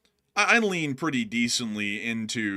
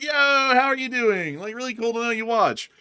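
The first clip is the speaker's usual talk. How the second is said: friendly greeting; 11 semitones higher, 5 dB louder than usual. Retold or imitated. Host greeting a fan in public, high hearty greeting. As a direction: greet them like an old friend. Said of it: "and I'm like".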